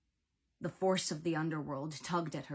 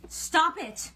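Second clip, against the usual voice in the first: high, loud outburst; angry yell; 12 semitones higher, 10 dB louder than usual. A narrator's reading. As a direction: yell to make it stop. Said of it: "Nico yelled".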